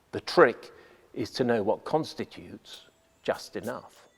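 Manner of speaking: ordinary talk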